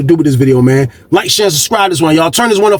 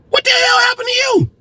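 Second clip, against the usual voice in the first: high, startled yell of a female guard; shocked shout. Retold or imitated. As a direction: cry out in shock at his face. Guard shocked at prisoner's face.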